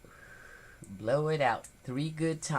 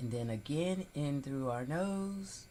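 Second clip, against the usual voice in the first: guided breathing; 9 dB quieter than usual. Acted. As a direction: talk them gently through each breath. Leads a breathing exercise.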